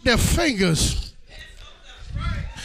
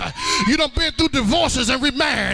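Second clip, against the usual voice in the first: driving sermon cadence with gasped "uh" breaks; high, urgent testimony to a congregation; full-force preaching; 4 semitones higher than usual. Real, not acted. Preacher rousing the congregation to testify, full-force preaching.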